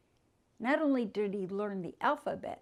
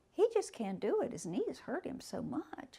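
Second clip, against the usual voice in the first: ordinary delivery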